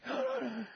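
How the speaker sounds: a small sharp gasp; a restless sleeper gasps at the clock; mock gasp. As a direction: gasp at the time. Noticing the clock time.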